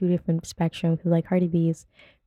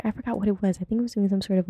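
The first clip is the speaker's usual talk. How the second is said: usual speaking voice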